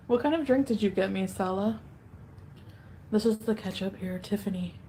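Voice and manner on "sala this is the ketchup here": usual speaking voice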